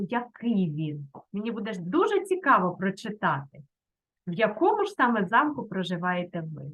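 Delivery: normal talking voice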